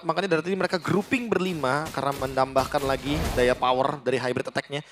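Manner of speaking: ordinary talk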